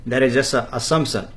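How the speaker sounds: usual speaking voice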